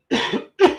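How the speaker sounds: hacking chesty cough. Real, not acted. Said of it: "sorry I'm coughing through it".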